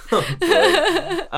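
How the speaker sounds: laughing oh, pitched high. Real, not acted. Laughing at the joke.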